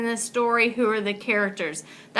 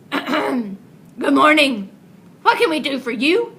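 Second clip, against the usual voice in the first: chief frog; 3 semitones higher, 5 dB louder than usual. Acted. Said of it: "the chief frog spoke up".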